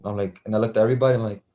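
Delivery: ordinary delivery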